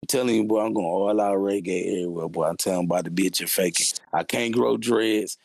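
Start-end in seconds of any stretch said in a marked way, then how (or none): none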